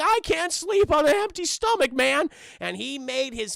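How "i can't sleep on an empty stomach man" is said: high-pitched goofy slacker protest; Shaggy. Retold or imitated. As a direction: put on the cartoon stoner voice. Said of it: "He's like".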